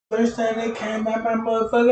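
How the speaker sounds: usual speaking voice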